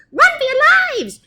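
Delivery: high, fast, loud squeaky panic; terrified squeaky soldiers; panicked mice. Acted. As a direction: shriek and run.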